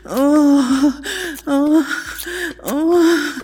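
repeated high moaned ohs; moaning. Acted. Lover moaning repeatedly during sex, high and breathy.

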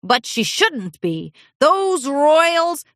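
put-on slow, gruff old pony voice; grumpy old stallion. A narrator's reading. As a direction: grumble about the royals.

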